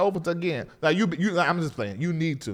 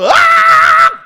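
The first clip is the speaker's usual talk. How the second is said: very high, loud sustained scream-note; singer hollering; 13 dB louder than usual. Retold or imitated. Host imitating June hollering high notes in church, screamed. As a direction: wail out a piercing high note. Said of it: "June over there hollering".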